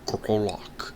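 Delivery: unvoiced whispered name; whispered reply. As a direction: breathe the name out. Troll answers in whisper.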